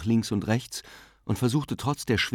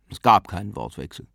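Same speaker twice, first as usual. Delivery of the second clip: sullen; 3 dB louder than usual. A narrator's reading. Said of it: "sagte Gabriel missmutig".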